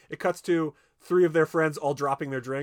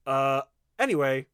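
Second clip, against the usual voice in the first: awkward loud exit; 3 semitones higher, 7 dB louder than usual. Retold or imitated. Classmate hurries awkwardly away.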